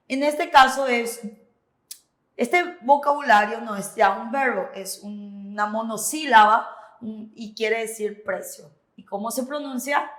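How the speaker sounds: usual speaking voice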